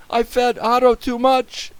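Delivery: high, loud wail; desperate confession. A narrator's reading. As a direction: admit it in distress. Boy confesses to Mr. Carp.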